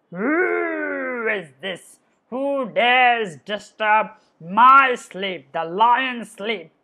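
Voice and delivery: a slow, drawn-out sleepy lion roused in anger; lion woken from his sleep. Acted. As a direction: boom it out like a disturbed lion king. Lion voice in fable.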